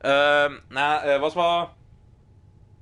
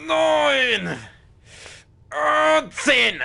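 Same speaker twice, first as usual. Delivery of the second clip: straining; 10 semitones higher, much slower than usual. Pretend. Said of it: "Oh, ist das anstrengend".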